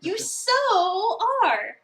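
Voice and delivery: playful sing-song tease, high and loud; teasing. Acted. Teasing a starstruck friend, loud slow sing-song.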